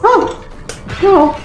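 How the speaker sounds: ordinary delivery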